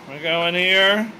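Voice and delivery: normal talking voice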